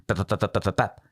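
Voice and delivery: rapid-fire percussive baps; imitated brush beating. Speaker mimicking the brush being cleaned, rapid baps. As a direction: mimic a brush slapping back and forth. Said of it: "And that sound".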